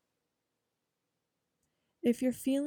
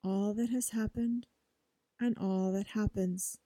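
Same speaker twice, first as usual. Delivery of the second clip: quiet, measured mantra recitation; soft affirmation. Shown as a demonstration. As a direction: say each phrase softly like a prayer. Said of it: "you are saying".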